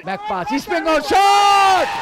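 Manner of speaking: rising shout on the shot; excited shout. Real, not acted. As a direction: call the shot loud and high. Live commentary, shot on goal.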